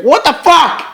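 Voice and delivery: high exclamation of disbelief. Real, not acted. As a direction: burst out, pitch shooting up. Reacting to the absurd story.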